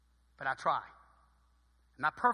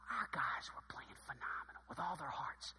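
hushed, unvoiced whisper; hushing the congregation at the big moment; 3 semitones lower, 12 dB quieter than usual. Real, not acted. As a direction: whisper it like a secret in church. Hushed telling of the comeback.

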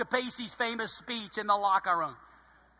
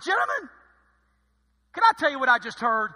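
loud, high call to gather the team; a coach summoning his players, loud and high; rousing coach; 6 semitones higher, 10 dB louder than usual. Retold or imitated. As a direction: grab the room, coach. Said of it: "I'm like".

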